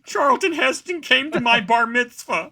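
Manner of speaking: high, loud boasting; bragging fan. Retold or imitated. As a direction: show off like a starstruck fan.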